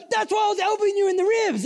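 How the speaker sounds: high, loud mock yell of exasperation; exasperated scolding. Chloe scolding her companion after the swindle, exasperated scolding. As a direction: play the partner who saw it coming.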